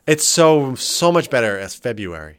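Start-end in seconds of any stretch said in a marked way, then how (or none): 1.8-2.4 "February" as FEB-yoo-ary; cleaner pronunciation. Shown as a demonstration. Arguing it sounds better, "February" as FEB-yoo-ary. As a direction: glide "February" through yoo, no first R. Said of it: "It sounds so much cleaner".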